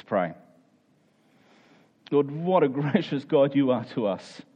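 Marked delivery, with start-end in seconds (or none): none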